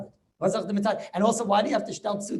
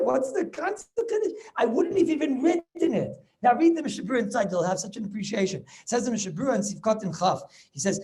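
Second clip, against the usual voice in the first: ordinary talk, nothing marked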